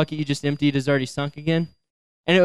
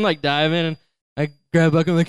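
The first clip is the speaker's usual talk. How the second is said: usual speaking voice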